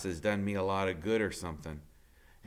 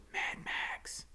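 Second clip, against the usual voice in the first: breathy whisper with no voice; whispered jeer in the hall; whispered taunt; 9 dB quieter than usual. A narrator's reading. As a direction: hiss the nickname behind his back. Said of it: "you can hear the whispers".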